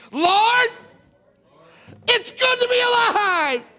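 high, slow, loud joyful cry; joyful shout. Shown as a demonstration. Preacher shows teens how to greet the day, loud and joyful. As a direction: shout your thanks to the morning. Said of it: "Say it".

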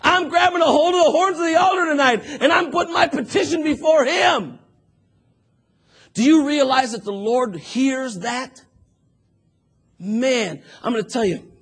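loud, high, fervent sermon voice; fervent preaching. Acted. Preacher rousing the church about Hannah's prayer, loud and fervent. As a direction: preach it hard to the congregation.

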